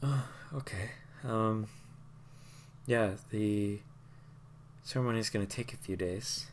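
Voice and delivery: soft, subdued reply; grieving woman. Acted. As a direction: speak gently, weighed down by loss.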